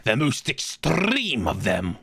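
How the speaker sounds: slow, with trilled Rs; rolled r. Acted. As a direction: trill every R in extreme. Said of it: "Why do you roll your R's like that".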